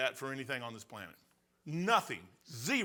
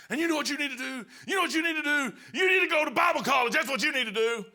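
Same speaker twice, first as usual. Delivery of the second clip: higher, louder shouted rant at the preacher; angry pastor shout; 11 semitones higher, 6 dB louder, faster than usual. Retold or imitated. Angry pastor yelling at the preacher to go to Bible college. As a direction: bellow it like a furious pastor laying down the law. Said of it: "And he goes".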